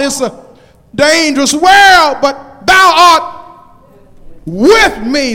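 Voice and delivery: loud, high, defiant psalm shout; full-force preaching. Acted. Preacher declaring he will fear no evil, full-force preaching. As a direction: cry out each phrase to the congregation.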